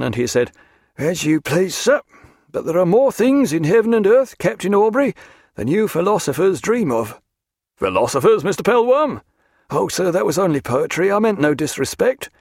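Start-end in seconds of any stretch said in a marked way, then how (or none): 1.0-7.2 higher, louder, stubborn retort; dogged. A narrator's reading. Seaman defends omens to his sceptical captain, dogged. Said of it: "took on a dogged expression". 7.8-9.2 incredulous question, leaping up on the name; taken aback. A narrator's reading. Captain challenges seaman's jibe about philosophers, taken aback. 9.7-12.3 higher, placating voice; apologetic. A narrator's reading. Seaman excuses his poetry to the captain, apologetic.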